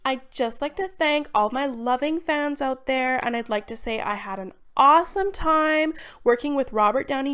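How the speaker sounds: high, loud celebrity gushing; pop star speech. Acted. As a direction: gush to adoring fans like a diva. Celebrity thanking fans on stage.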